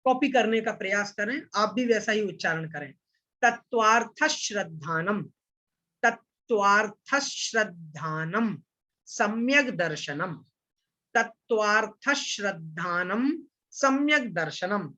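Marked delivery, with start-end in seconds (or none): 3.4-14.9 तत्वार्थ श्रद्धानम said slowly, श्र blended with था, short final a; slow model recitation. Shown as a demonstration. Teacher modelling the sutra for online students, slow careful recitation. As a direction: chant it slowly for the class to copy. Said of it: "मेरे उच्चारण को आप लोग ध्यान से सुनिएगा".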